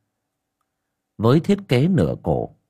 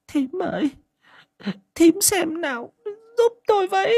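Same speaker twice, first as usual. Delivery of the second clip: much higher, choked pleading voice; choked murmur. A narrator's reading. Mother Ngà begging the aunt for help, choked up. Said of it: "nghẹn giọng thủ thỉ".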